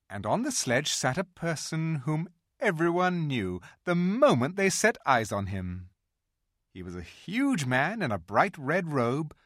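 ordinary delivery

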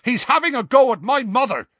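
high, loud outraged yell. A narrator's reading. Seamus yelling to Ron about his mother, high and loud. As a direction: yell the accusation. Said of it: "Seamus yelled".

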